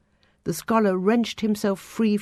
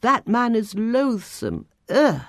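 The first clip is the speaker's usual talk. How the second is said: raised voice, recoiling with disgust at the end; called out in disgust; 5 semitones higher, 4 dB louder than usual. A narrator's reading. Scholar calls out his disgust at a passer-by, loudly. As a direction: call it out, spitting the ugh. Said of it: "as he called out".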